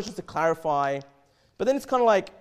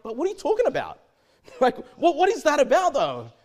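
higher, louder puzzled outburst; baffled question; 7 semitones higher, 6 dB louder than usual. Retold or imitated. Boss to his employee, baffled and louder. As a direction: sound lost and bewildered at the half conversation.

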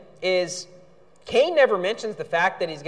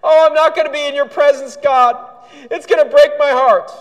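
higher, louder sarcastic whimper; mock whining Cain; 6 semitones higher, 7 dB louder than usual. Preacher mocks Cain's lament to God, sarcastic and whiny. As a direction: whine it like a fake-sorry sinner. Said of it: "And from thy face shall I be hid".

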